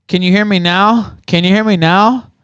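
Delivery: loud, high furious taunt; angry yell. Real, not acted. Streamer shouting at chat during stream trouble, loud angry. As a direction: bellow it at them.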